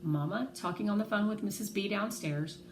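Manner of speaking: ordinary delivery, nothing marked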